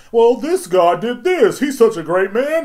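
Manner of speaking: loud, high, drawn-out fake gushing; mock a gushing magazine reader, high and loud; mocking fan voice. Sneering at celebrity worship, loud mocking fan voice.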